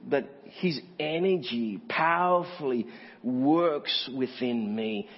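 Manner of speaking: slow, weighty ENERGY POWERFULLY WORKS; emphatic recital. Acted. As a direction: draw out each of ENERGY, POWERFULLY, WORKS with pauses. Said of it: "You ever speak like that?".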